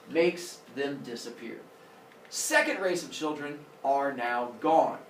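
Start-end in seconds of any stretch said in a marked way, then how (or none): none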